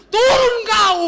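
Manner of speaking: very high, loud bellow; acted parent's shouted command; shouted order. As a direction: yell up the tree at full voice. Orders child down from tree.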